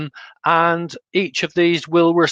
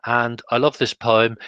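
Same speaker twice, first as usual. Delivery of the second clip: usual speaking voice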